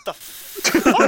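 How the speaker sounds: high, incredulous the fuck; shocked. Real, not acted. Answer is Ronald McDonald, a shocked blurt. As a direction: blurt it in disbelief.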